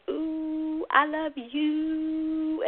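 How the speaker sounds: "Ooh" and "you" held long and high; high, drawn-out "Ooh, I love you" with rounded lips; puckered oo. Shown as a demonstration. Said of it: "pucker up for a kiss".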